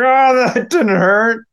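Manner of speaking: pained tough-guy front after hitting his ankle; strained bravado. Retold or imitated. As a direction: fake it through the pain. Kid hurt, pretends he's fine.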